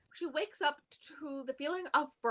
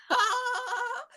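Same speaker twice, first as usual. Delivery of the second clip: high, drawn-out "ah" through laughter; hammed-up horrified wail, far above normal pitch; laughing imitation of a stunned agent; mock shocked cry; 11 semitones higher, 3 dB louder than usual. FBI agent shocked by casual news.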